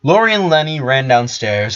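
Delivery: ordinary delivery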